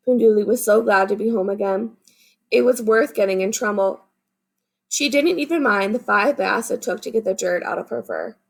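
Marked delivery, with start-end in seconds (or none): none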